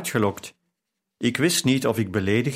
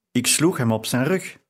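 ordinary delivery, nothing marked

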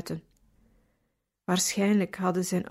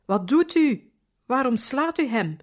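higher and louder, stopping a beating; loud call; 5 semitones higher, 4 dB louder than usual. A narrator's reading. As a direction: cry out to stop him hitting the boy. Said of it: "riep ik luid".

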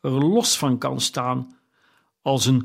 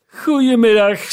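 furious dismissal; 9 semitones higher, 7 dB louder than usual. A narrator's reading. Scrooge's last furious goodbye to his nephew, much higher and louder. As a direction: bark him out of the room.

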